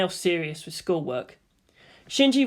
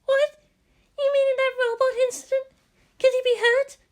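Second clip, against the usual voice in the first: much higher, worried schoolgirl voice; a girl suddenly frightened for a friend; Hikari alarmed. Acted. Hikari hearing Toji's house is gone, high and worried. She faltered.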